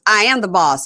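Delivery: playfully emphatic retort; assertive woman imitation. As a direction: declare it firmly, as her. Voicing woman asserting herself.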